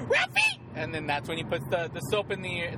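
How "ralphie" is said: high, loud shriek of a name; mock-outraged mother yelling her son's name; mom's shocked yell. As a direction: shriek the kid's name like a mom who just heard a swear. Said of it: "and she goes".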